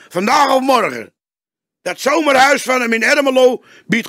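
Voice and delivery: put-on young detective warning his boss, despairing. A narrator's reading. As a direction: plead with growing alarm.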